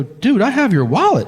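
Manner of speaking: thrilled announcement; excited. Retold or imitated. Excited reveal.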